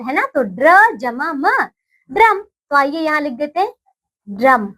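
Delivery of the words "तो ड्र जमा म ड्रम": put-on sound-blending for children. Shown as a demonstration. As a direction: build ड्रम from its parts. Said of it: "ये है ड्रम का चित्र".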